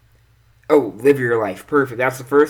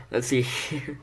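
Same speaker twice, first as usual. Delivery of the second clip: ordinary talk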